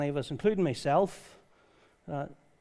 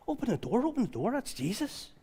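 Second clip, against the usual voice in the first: higher, urgent repeated plea; rushing to let him in; eager excitement; 8 semitones higher than usual. Acted. Listener recognising Jesus' voice, eager and high. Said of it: "I go".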